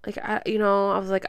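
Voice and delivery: ordinary talk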